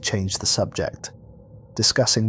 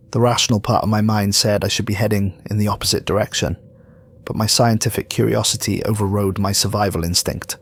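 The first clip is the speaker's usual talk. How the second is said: plain reading voice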